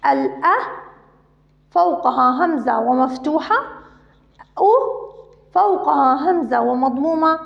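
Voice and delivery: ordinary talk, nothing marked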